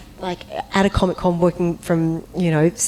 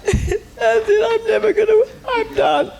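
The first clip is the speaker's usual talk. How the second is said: high, wailing cry; mock howling; 3 dB louder than usual. Crying in the shower over the lost job, hammed-up wailing. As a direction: wail like all hope is lost.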